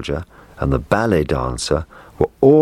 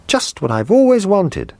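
put-on excited child; 3 dB louder than usual. A narrator's reading. Boy thrilled with present.

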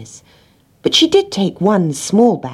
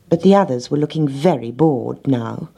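usual speaking voice

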